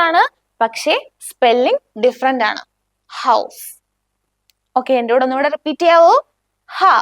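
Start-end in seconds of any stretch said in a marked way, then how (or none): none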